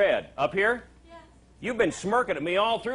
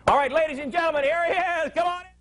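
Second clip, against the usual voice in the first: ordinary talk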